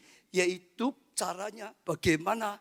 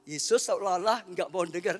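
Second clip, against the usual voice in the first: ordinary talk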